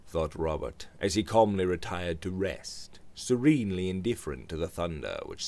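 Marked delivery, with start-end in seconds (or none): none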